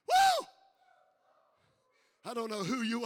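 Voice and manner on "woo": high loud preacher whoop; excited whoop. Real, not acted. Preacher to church, a high excited whoop. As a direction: let out a joyful whoop.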